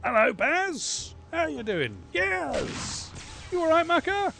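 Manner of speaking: high, far-off cartoon voice greeting a mate; silly high greeting voice. Gamer greeting his base characters in a silly high voice. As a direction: squeak a cheery hello at the character.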